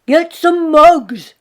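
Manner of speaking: slow, loud call for mugs; Michael shouting for mugs at the table; loud order. Acted. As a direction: boom it out like a hungry guest.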